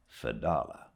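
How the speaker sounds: ordinary delivery, nothing marked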